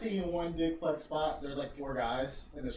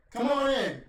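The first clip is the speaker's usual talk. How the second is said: mock booming welcome; 11 semitones higher, 10 dB louder than usual. Taker inviting the delivery guy.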